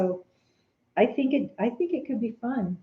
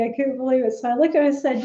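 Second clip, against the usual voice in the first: ordinary delivery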